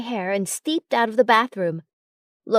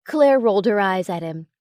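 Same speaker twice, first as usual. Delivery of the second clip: ordinary delivery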